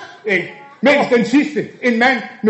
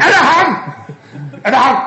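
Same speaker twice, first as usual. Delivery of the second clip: higher and louder, laughing through it; louder retake; 4 semitones higher, 3 dB louder than usual. Acted. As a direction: push the question up with a laugh. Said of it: "Den tager vi".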